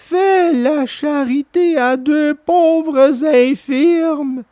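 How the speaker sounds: high, slow, drawn-out whine; the fox begs pitifully; the fox whines at Pinocchio for charity; whining fox. A narrator's reading. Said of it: "d'une voix pleurnicharde".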